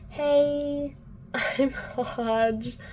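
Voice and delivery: a hint of a cheery mentor introducing himself; Hodge. Mentor Hodge voiced in recap.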